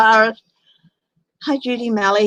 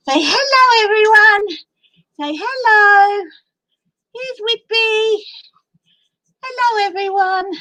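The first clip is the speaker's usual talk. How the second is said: pet-talk voice; 12 semitones higher, 4 dB louder than usual. Real, not acted. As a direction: show off the puppy in a sweet high voice. Presenting the dog to viewers.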